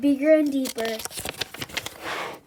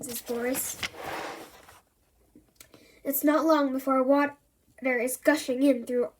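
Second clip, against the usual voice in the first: plain reading voice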